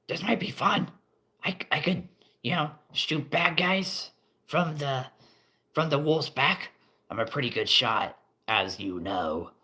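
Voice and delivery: high, eager little goblin. Acted. Goblin showing off his bow to the wizard, high and eager. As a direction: pitch it up like a keen goblin.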